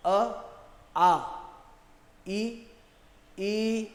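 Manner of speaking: अ clipped short then आ drawn out, ई short then ई long; short and long vowels. Shown as a demonstration. Teacher contrasting short and long vowels for students. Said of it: "ये अंतर है दोनों में".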